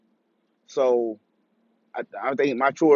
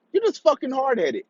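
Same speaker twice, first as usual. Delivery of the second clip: jumps higher in disbelief; higher exasperated repeat; 9 semitones higher than usual. Retold or imitated. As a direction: repeat it higher, fed up with him. Ranting at rebellious brother.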